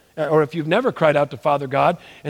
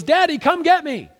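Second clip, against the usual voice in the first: put-on little boy pleading; 12 semitones higher than usual. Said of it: "He goes".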